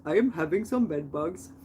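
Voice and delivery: lightly imitated Indian accent; imitated Indian neighbor. Indian neighbor explains why he's on the stairs, light Indian accent. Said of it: "one of my Indian neighbors".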